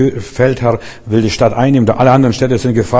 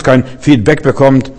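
ordinary talk

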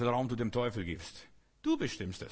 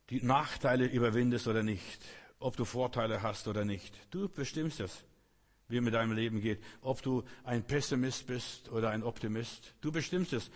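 ordinary delivery

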